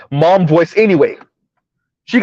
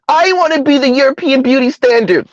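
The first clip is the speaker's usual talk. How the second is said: mock woman's wish; 7 semitones higher than usual.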